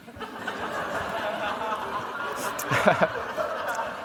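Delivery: high, drawn-out giggle trailing off; long high laugh. Real, not acted. Laughs at own joke story.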